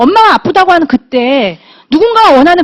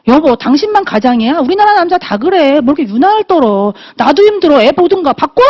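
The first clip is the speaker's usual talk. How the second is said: higher-pitched retold nagging; nagging wife; 4 semitones higher than usual. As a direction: complain at your husband, pitch jumping up. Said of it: "그렇게 잔소리하기 바빴어요". (남편에게 따지듯 잔소리하는 말투로)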